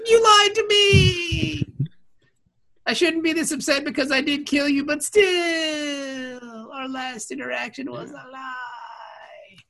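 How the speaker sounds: high, slow, crying mock wail; mock anguish. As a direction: wail it like a betrayed hero. Distraught character shouting.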